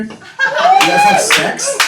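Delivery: shrieking falsetto ask; squeaky high question. Acted. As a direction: squeal it at the crowd.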